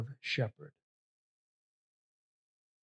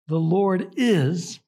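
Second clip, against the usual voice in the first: IS stretched out and louder; stress on is; 3 semitones higher, 4 dB louder, much slower than usual. Shown as a demonstration. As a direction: punch "is", slow and emphatic. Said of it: "present tense".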